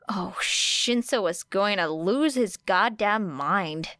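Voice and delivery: ordinary delivery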